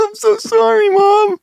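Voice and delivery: high, wailing fake sob; mock crying. Partner mocking the crying guy, fake sobbing apology. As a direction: wail it like a man crying for his mom.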